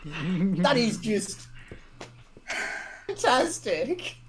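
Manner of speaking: hammed-up, drawn-out false delight; forced delight. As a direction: drag it out, ending high on strained cheer. Feigning delight at bad news.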